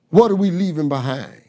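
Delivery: usual speaking voice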